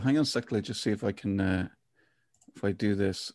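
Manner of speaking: ordinary talk, nothing marked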